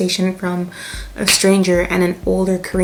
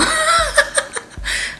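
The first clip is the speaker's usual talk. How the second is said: high burst of laughter. Real, not acted. Laughing at accent lesson.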